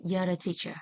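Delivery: "You're a teacher" linked in one quick run; connected speech. Shown as a demonstration. Teacher repeating the natural model, connected speech. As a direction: reduce "You're a" to "yer-a" before "teacher". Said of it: "we should say".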